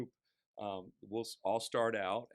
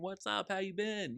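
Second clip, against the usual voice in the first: bright, much higher call of hello; friendly greeting; 10 semitones higher than usual. Retold or imitated. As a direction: greet an old friend on the street, voice jumping up. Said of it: "He calls me by name".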